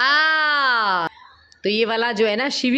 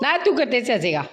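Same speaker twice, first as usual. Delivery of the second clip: ordinary talk, nothing marked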